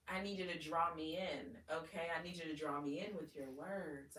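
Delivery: ordinary talk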